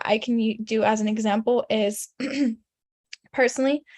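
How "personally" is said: ordinary delivery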